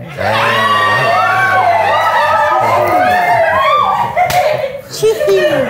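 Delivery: very high, loud burst of laughter; big shrieking laugh. Real, not acted. Host cracks up at kiss joke.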